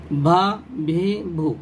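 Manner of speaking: بھا، بھی، بھو stretched out very slowly, syllable by syllable; slow drill reading. Shown as a demonstration. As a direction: drag each syllable for the children to copy. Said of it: "اس کو بھو پڑھیں گے". (بچوں کو سکھانے کے لیے بہت آہستہ)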